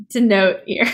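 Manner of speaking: ordinary talk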